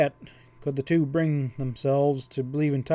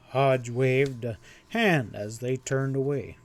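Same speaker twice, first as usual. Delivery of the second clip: usual speaking voice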